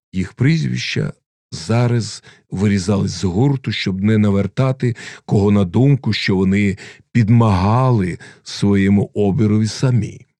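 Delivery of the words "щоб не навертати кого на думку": usual speaking voice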